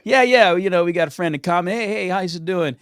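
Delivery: loud, high, fast eager hello; eager greeting. Retold or imitated. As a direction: greet the interviewer too eagerly. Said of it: "walking into the interview".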